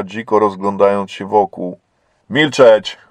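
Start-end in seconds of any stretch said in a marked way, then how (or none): 2.3-3.0 a sharp, loud "Milczeć!"; chief's angry shout to silence her; shouted order. A narrator's reading. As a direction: snap one word of command. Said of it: "krzyknął na nią naczelnik".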